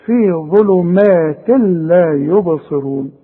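slow ظلمات لا يبصرون, clean merge into laam; drawn-out model reading, tanween of ظلمات silent; no ghunna. Shown as a demonstration. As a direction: recite ظلمات لا with no nasal hum. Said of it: "ما تغنش هنا".

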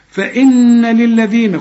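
فإن للذين with a long, light N; light held N. Shown as a demonstration. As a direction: slowly hum the N of فإن before للذين. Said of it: "شوف النون بقى جات لنا إزاي مرققة".

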